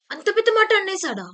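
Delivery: high, loud outraged question; can you believe he said that; indignant disbelief. Shown as a demonstration. Teacher acting outrage for the class. Exclamation example, shocked.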